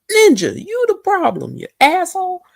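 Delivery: high, loud mock yell at him; high indignant retort; mock outburst. Talking back at TV husband.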